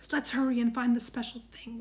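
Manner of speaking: usual speaking voice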